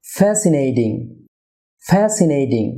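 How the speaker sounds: "ফ্যাসিনেটিং" as FAE-si-ney-ding; correct pronunciation. Shown as a demonstration. Teacher models for viewers, "ফ্যাসিনেটিং" with D for T. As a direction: soften the T of "ফ্যাসিনেটিং" to D. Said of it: "টি এর উচ্চারণ ডি হবে".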